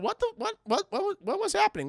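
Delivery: high, stammering confusion; comic high-pitched disorientation; mock bewilderment. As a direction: sputter it out, totally baffled. Bewildered hero after time reversal.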